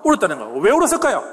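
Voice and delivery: ordinary talk, nothing marked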